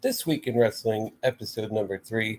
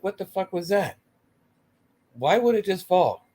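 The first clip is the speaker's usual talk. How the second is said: baffled outburst; 7 semitones higher than usual. Retold or imitated. Baffled reaction to coffin spot.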